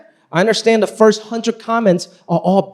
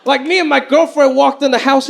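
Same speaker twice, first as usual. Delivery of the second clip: ordinary talk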